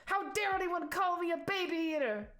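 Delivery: high, loud hammed-up anger; mock fury. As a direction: whine about being insulted, overplayed. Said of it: "Enzo's like".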